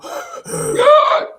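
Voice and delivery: loud, high preacher's gasp; exaggerated loud sucked-in breath; hooping gasp. Shown as a demonstration. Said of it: "don't know what hooping is, that's when you go".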